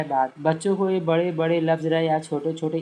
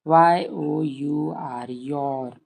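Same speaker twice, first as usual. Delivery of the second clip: slow spelling drill; much slower than usual. Shown as a demonstration. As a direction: spell y-o-u-r out slowly. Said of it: "ऐसे रटवाइए".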